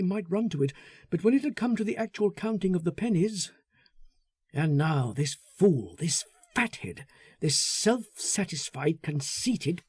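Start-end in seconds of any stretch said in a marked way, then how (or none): none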